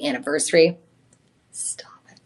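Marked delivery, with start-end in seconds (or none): 1.5-2.2 hushed, whispered disbelief; whispered exclamation. Acted. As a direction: whisper it like you can't believe it. Emphatic sales exclamation, hushed.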